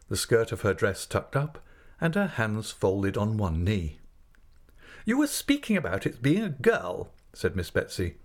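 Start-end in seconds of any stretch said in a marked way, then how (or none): 5.0-7.1 high, emphatic character voice; decisive aunt, pitched up; brusque aunt figure. Acted. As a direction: take charge in a high, firm voice.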